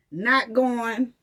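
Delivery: ordinary delivery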